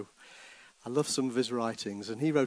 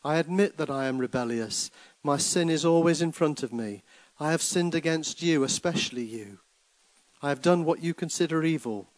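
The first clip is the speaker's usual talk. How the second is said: psalm reading; 3 semitones higher, 5 dB louder than usual. Acted. Reads Psalm 51 as prayer.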